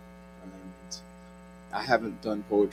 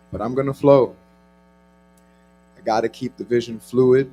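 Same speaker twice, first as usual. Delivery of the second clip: spoken word poetry; 13 dB louder than usual. Acted. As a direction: perform it like a slam poet. Performs spoken word poem.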